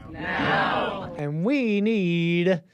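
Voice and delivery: mock protest chant, drawn out and loud; rally speech. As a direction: belt it like a rally leader. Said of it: "a little penchant for the flair".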